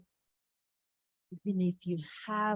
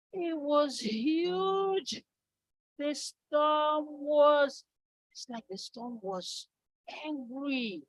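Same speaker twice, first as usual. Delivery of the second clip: higher, slowed and swelling up to a shout on "hard"; dramatic storm build; 8 semitones higher, 3 dB louder, slower than usual. A narrator's reading. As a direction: paint the raging storm bigger and bigger. Storytelling builds the great storm.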